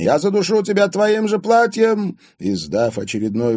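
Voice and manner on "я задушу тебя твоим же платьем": very high, loud enraged wail; yelling. A narrator's reading. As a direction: scream a threat from inside the wardrobe. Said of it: "вопила". (истошный злой вопль)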